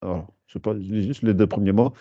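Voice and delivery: ordinary talk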